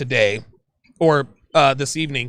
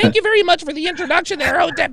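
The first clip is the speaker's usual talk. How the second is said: much higher and louder, squeaky and thin; 4 dB louder, faster than usual. Shown as a demonstration. Host mocks his own voice after the boss voice, squeaky high. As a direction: jump way up into a tiny squeaky voice. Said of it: "I have a very high-pitched voice".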